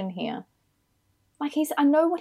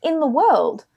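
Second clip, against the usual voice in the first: louder, higher, incredulous telling-off; exasperated scolding; 4 semitones higher, 5 dB louder than usual. Acted. Co-host berating an absent actor over his award nomination, exasperated. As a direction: scold in loud disbelief, pitch swooping up.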